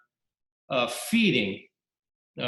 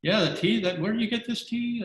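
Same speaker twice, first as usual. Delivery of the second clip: charmed officer; 10 semitones higher than usual. Acted. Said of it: "And the guy says".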